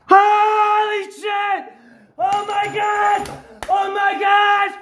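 real jackpot hollering with long stretched vowels; ecstatic shouting. Real, not acted. Player tells viewers of a biggest-ever win, ecstatic shouting. Reveals five thousand dollar win.

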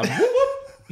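high mock police siren with a laugh; siren whoop. Friend imitates police siren while laughing, high whoop. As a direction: imitate a cop car siren. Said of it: "they're like".

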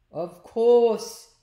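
hearty storybook exclamation; emphatic agreement. A narrator's reading. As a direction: boom it out as it dawns on you. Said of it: "agreed Slime".

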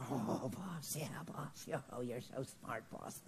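low, whispery yes-man voice; fawning henchman. Acted. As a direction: suck up to the boss in a hushed simper. Plays the devil's fawning underling.

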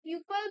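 "পিপল" said wrongly as "pyu-pul"; "পিপল" made to sound like pupil; mispronounced people. Shown as a demonstration. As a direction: imitate the learner's slip, a "yoo" glide in "পিপল". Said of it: "তোমার প্রনাউন্সিয়েশনের problem".

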